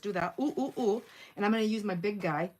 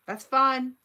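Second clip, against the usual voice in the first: That's fine said louder and higher, in a huff; loud, higher mock-offended "That's fine"; mock huffy dismissal; 6 semitones higher, 8 dB louder than usual. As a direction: toss it off like a hurt mom brushing off her kids. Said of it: "I was like".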